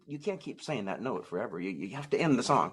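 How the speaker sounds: ordinary talk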